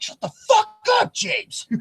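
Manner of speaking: sudden high shout; yelling at a friend. Retold or imitated. Friend yelling shut up at his buddy. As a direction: scream it at him, half joking. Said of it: "I'm like".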